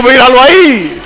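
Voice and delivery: high, loud, laughing "Míralo ahí". Retold or imitated. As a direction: crow it through laughter. Laughing at his wife's doubt.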